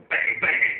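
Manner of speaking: loud, punchy "bang bang"; shouted joke-gun shots; mock gunfire. Comic firing joke guns after his punchline, shouted mock gunfire. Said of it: "that's joke guns right there".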